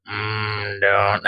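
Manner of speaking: low cartoon dad, breaking off; low, half-hearted cartoon dad attempt; Homer Simpson. Retrying a voice he can't hit, weak Homer impression. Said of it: "can't hit Homer".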